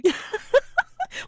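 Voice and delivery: high rising laugh; burst of laughter. Real, not acted. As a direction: laugh out loud. Laughing at bloody handprints joke.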